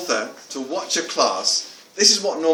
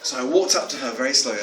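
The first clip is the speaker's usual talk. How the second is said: ordinary talk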